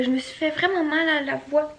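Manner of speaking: usual speaking voice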